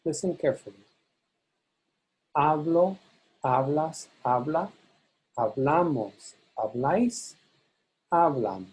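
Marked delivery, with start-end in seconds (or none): 2.3-8.7 HA stressed in "Hablo, hablas, habla, hablan", BLA in "hablamos, habláis"; stress shift. Shown as a demonstration. Teacher demonstrates for careful listeners, stress shifting to BLA in "hablamos, habláis". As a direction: list each form, shifting weight to BLA for "hablamos, habláis". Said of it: "hear this stress shift".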